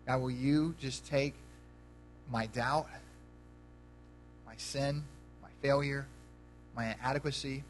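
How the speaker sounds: soft, low prayer voice; hushed closing prayer. Acted. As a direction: lead the room quietly into prayer. Pastor leads prayer.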